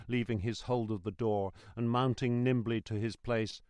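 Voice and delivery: plain reading voice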